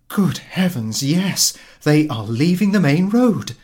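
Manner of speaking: high, loud alarmed cry; alarmed wife. Acted. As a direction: she spots the carriages turning in and panics. Wife alarmed seeing visitors.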